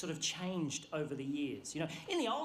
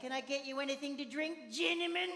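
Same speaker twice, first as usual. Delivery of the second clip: sing-song cabin crew announcement; chirpy airline PA voice; flight attendant voice; 4 semitones higher than usual. Retold or imitated.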